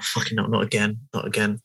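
low, hushed retold dread; dismayed mutter. Retelling his dread at seeing another tequila glass, quietly dismayed.

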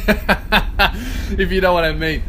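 "lesser of two evils" said through laughter, slowing at the end; laughing. Real, not acted. Laughing as he delivers his punchline to listeners.

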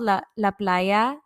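slow, careful Spanish pronunciation; Spanish-accented name, drawn out. Real, not acted. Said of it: "with such a great accent".

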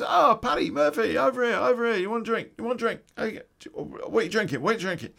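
high, loud Irish lads hailing a mate; calling out across the club. Retold or imitated. Irish guys at the nightclub calling him over, high and loud. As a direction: shout over the music to grab his attention. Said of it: "the Irish guys".

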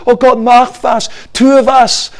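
a pretend plea for help; pleading prayer. Us begging God to act, high whiny plea. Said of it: "oft ist es".

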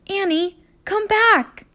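high, loud urgent call. Acted. Jack calls Annie down from the ladder, high and urgent. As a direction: call her back down.

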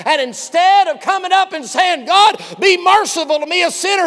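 shouting, high pulpit preaching; loud fiery sermon; preaching at full force. Acted. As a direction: thunder it out at the congregation.

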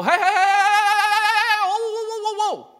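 very high, loud, half-sung "hey hey whoa"; a friend waving you down, singsong; mock frantic stopping. Acting someone stopping him.

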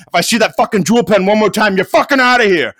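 high, loud hammed-up threat; mock furious threat. Steve warning the vaping guy, mock furious threat. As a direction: imitate an angry usher laying down the law. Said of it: "Steve was like".

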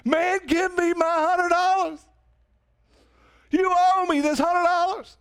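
mock rough collector; demanding debt. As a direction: lean on him for the money. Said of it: "starts choking him".

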